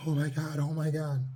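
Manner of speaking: high, gasping panicked cry; a frightened child's panic; panicked girl. Retold or imitated. Little girl seeing goose run over, panicked high cry. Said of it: "like, panicked".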